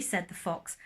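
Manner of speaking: usual speaking voice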